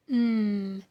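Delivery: steady held "mm"; voiced hum. Shown as a demonstration. Teacher showing throat vibration, held hum. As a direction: hum one sustained "mm". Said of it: "That's a voiced sound".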